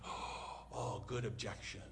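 breathless, whispered mock alarm; mock awed gasp. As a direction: gasp it as if rattled by the point.

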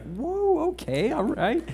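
high, startled mock surprise; a quick amused double take; surprised whoa. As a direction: jump up in pitch like caught off guard. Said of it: "which I was like".

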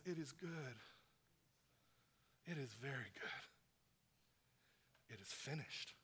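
tender. Acted. The Father speaks tenderly to you.

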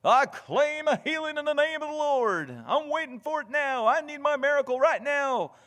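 high, loud, demanding mock faith-healer voice; mock a believer demanding a miracle now; prosperity gospel claimer. Mocking a prosperity preacher's flock, high and loud. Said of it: "like a prosperity gospel promise".

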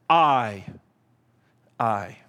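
"I, I." repeated slowly with weight; stress on I. Shown as a demonstration. Preacher repeating Jesus's word "I", emphatic. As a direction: drive home the word "I" twice. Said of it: "Jesus says".

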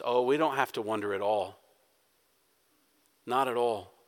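soft, low comfort to a worried congregation; soft reassurance. Real, not acted.

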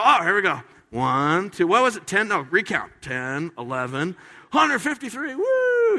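hammed-up fishermen counting the catch, high and loud; mock excited counting. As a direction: count the fish in a rush, then cheer. Counting fish excitedly, acted.

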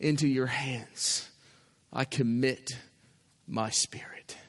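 low, soft and halting, fading to a whisper; hushed surrender, trailing away; dying last words. Retold or imitated. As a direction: act out giving up the last breath. Jesus' dying words acted out.

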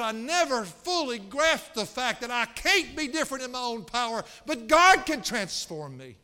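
loud, high preacher quoting a convert; impassioned, full-force quote; fervent retold testimony. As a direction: cry out the testimony from the pulpit, slowing at the climax. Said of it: "He said".

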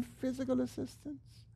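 timid, proper plea; a hint of the prim Englishman. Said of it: "we're saying".

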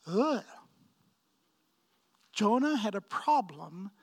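usual speaking voice

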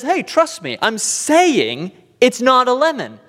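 lightly voiced salesman insisting it's fine; slick salesman. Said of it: "He says".

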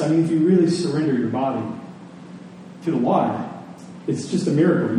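ordinary talk, nothing marked

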